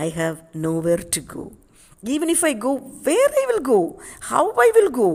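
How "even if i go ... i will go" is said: high, loud, anguished cries; a broken man crying out where to go; anguished despair. Acted. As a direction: wail each question out in despair. Aksionov despairs over lost years.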